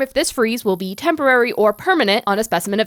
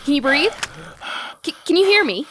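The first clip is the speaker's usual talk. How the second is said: urgent pleading; 4 semitones higher than usual. Acted. Dan urges rescued, limp Taylor.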